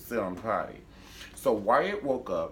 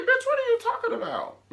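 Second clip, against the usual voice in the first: much higher, louder squeal; shrieking outrage; 8 dB louder than usual. Retold or imitated. Character accused of murder, shrieking outrage. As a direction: screech it in mock disbelief. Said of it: "she's like".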